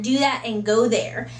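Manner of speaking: usual speaking voice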